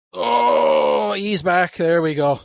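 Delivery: straining. Real, not acted. Straining at end of set.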